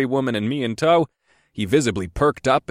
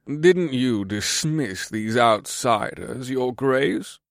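sneering; 3 semitones higher, much slower than usual. A narrator's reading.

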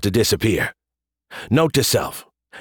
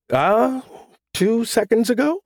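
sheepish; 11 semitones higher than usual. A narrator's reading. Sheepish admission.

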